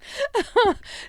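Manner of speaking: short high burst of laughter. Real, not acted. Mother laughing at her own watering eyes, high and short. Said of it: "So that's quite funny".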